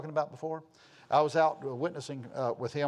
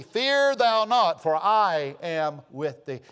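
loud, drawn-out proclamation of a verse; scripture proclamation; 3 semitones higher, 8 dB louder, much slower than usual. Acted. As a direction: stretch the words and proclaim them loudly.

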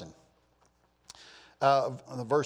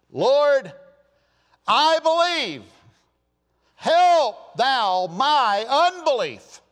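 high, loud, drawn-out desperate plea; tearful crying out; 11 semitones higher, 11 dB louder, much slower than usual. Acted. Father of the child begging Jesus, crying out with passion. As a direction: cry out as a pleading father. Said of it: "cried out and said with tears".

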